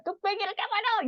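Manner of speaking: high, mild mock outburst; angry child. Anxious boy swearing at the counselor, mild mock anger. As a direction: throw it out like a cursing kid. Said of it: "그러면 얘가".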